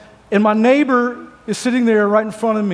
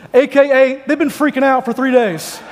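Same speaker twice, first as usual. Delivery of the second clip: usual speaking voice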